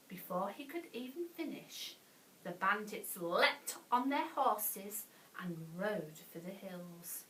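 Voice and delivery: plain reading voice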